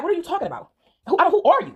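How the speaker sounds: high-pitched, baffled retort; incredulous, pitch lifted on the question; confused questioning. Retold or imitated. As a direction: act out bewilderment, stumbling into the question. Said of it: "looking confused".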